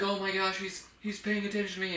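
high-pitched voice of a thrilled dog; excited dog. Acted. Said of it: "She would get so excited".

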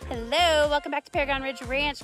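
usual speaking voice

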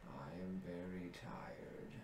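barely whisper, worn out; weary whisper. Acted. Treant tired, whispering.

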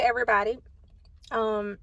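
finishing a bite; talking while chewing. Real, not acted. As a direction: mouth partly full. Seen 1: Lips closed, cheeks working at 0.1–1.0; later she talks holding food.

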